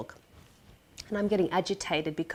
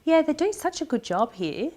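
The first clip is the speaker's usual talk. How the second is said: high, chirpy mock enthusiasm; oblivious delight; 10 semitones higher than usual. Patient naively praising the clinic to her partner, oblivious delight. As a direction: gush it in a raised, happy voice.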